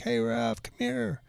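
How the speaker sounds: high, bright, animated call over; a lively, high imitation of Salma calling him over. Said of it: "she's so animated".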